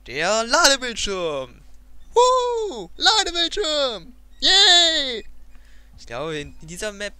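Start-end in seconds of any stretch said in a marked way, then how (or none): 0.1-1.6 drawn-out, loud, excited call. Real, not acted. Excited announcing the load screen. 2.1-2.9 high, loud cheering whoop; excited woo. Real, not acted. Cheering at the load screen. 3.0-4.1 high, loud, cheering shout; excited cheer. Real, not acted. Cheering at the load screen. 4.4-5.3 high, loud happy cheer; excited yay. Real, not acted. Cheering at the load screen.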